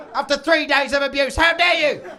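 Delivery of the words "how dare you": loud, high indignant cry; mock outrage. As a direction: throw it out, scandalized.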